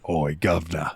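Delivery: low Cockney character catchphrase; Yangus. Acted. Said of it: "that Cockney accent with that rough voice".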